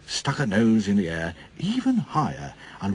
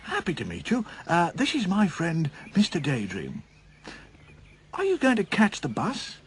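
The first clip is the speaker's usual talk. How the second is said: cheery Mister Happy; 7 semitones higher than usual. Acted.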